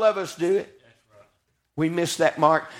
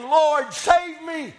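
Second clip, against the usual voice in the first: high-pitched preacher's plea; crying out; 8 semitones higher, much slower than usual. Acted. Peter sinking, calls to Jesus, crying out. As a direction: cry for rescue in a raised, preached voice.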